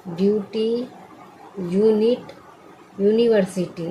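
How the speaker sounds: ordinary talk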